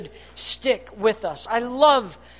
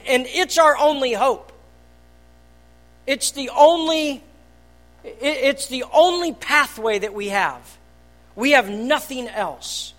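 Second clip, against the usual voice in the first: normal talking voice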